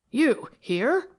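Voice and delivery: a touch of a startled, faltering question; lightly voiced caught-off-guard question; startled stammer. A narrator's reading. Fenwick, startled to find Zary, stammers in mild shock. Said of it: "he stammered".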